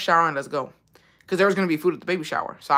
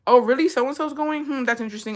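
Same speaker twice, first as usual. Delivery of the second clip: fake surprise; 7 semitones higher than usual. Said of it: "I would've been like".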